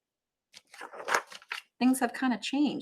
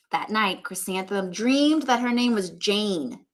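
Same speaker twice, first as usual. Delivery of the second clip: read-aloud storybook voice; story narration; 3 dB louder than usual. A narrator's reading. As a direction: read on to the class. Teacher reads book aloud.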